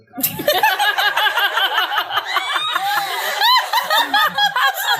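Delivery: very high, loud cackle; shrieking laugh. Real, not acted. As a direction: howl with laughter. Laughs at own joke.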